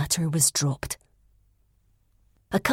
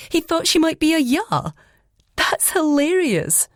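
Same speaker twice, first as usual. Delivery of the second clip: put-on high, louder amused girl; high character voice; 13 semitones higher, 4 dB louder than usual. A narrator's reading. Elspeth laughing at the story, high and amused.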